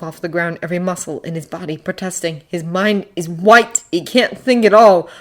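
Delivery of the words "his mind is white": ordinary delivery, nothing marked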